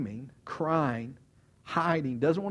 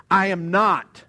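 loud, higher, emphatic outburst; cursing denial; 5 semitones higher, 12 dB louder, slower than usual. Acted. Peter denying Jesus to a third person, with a curse. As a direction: deny it forcefully like swearing. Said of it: "he says with another curse".

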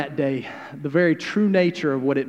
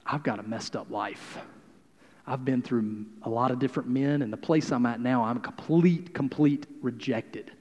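woman confessing her broken life, mildly sad; a touch of sadness; 4 semitones lower, 6 dB quieter than usual. Retold or imitated. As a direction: let it sink, ashamed. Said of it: "this woman just comes going".

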